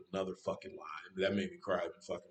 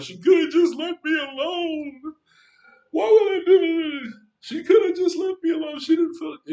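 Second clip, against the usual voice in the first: very high, loud wail of hurt; wounded, full-voiced howling protest; anguished outburst; 15 dB louder than usual. Anguished outburst after recalling crying.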